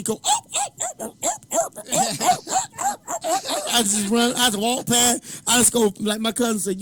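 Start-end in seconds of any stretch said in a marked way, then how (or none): 0.2-3.6 very high repeated whoops; whooping. Retold or imitated. Player runs past the other team, whooping. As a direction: imitate taunting whoops in a high falsetto.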